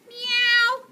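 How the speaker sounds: high loud meow; cat meow. Acted. The cats yowling, high and loud. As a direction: yowl like a cat.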